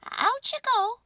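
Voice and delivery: high, squeaky little mouse giving an order; a tiny mouse shooing someone out. A narrator's reading. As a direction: mouse bossing the baby statue out of bed. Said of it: "Mortimer said".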